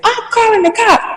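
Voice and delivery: outraged retort. Retold or imitated. Acted quarrel with the mother.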